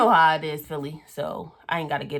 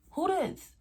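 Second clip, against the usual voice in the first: very high retold challenge; sharp high mock question; demanding; 13 semitones higher than usual. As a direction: demand to know who she is. Retold confrontation at the door.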